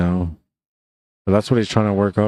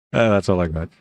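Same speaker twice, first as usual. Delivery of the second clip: ordinary talk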